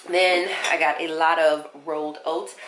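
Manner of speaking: usual speaking voice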